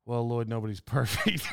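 low, soft, laughing through the punchline; laughing quote. Retold or imitated. Friend who left a four-star rating, lightly voiced with laughter. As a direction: relay the friend's line while cracking up. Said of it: "And he says".

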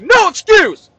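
loud, high barked command; yelling. Acted. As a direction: yell it like a fanatical gym coach. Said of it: "yelled at me".

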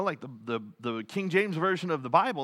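usual speaking voice

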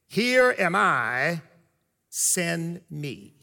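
slow, low and hushed; solemn scripture reading. Acted. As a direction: read it like holy words, slowly. Preacher reading scripture slowly.

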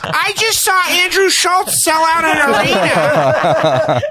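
very high, drawn-out nag; mock wife voice, way up high; nagging wife. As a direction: screech it like a hammed-up nagging wife. Acted nagging wife from Staten Island.

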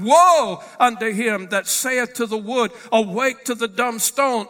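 loud, driving scripture proclamation; forceful preaching. Acted. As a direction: pronounce each woe like a judgment.

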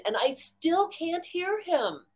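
high and slow, baffled; baffled disbelief. Retold or imitated. Telling the audience she still could not hear, slow baffled high. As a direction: stretch it out in disbelief. Baffled retelling of unheard message.

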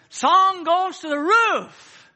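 high, loud, slow revivalist cry; drawn-out, soaring mock pulpit shout; imitating American preacher. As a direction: mimic an Afro-American preacher lifting the roof. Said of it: "an Afro-American preacher".

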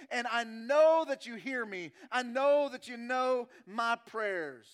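loud, driving preacher's voice; forceful preaching. Acted. Preacher declaring David knows God intimately, loud forceful preaching. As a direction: drive each phrase home to the congregation.

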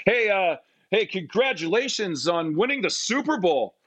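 high, eager congratulations; imitating an over-eager fan; awkward fan. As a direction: blurt it out high, like a nervous fan.

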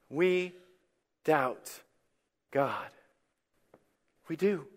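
soft, word by word with long pauses; grave admission. Real, not acted.

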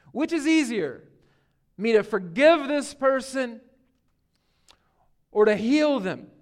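emphatic, loud rhetorical demand; challenging. Acted. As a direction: put the challenge to them hard. Preacher presses the challenge.

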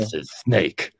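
a hint of gruff stealth soldier; Solid Snake voice. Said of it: "my Solid Snake voice".

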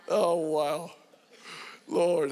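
usual speaking voice

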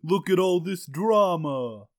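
slow, lightly done East Coast drawl; East Coast accent. Acted. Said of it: "obsession with East Coast accents".

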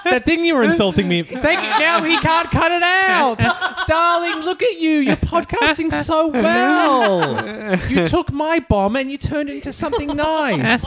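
high-pitched, over-the-top doting voice; hammed-up high falsetto praise; gushing put-on character. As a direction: gush in a silly high voice.

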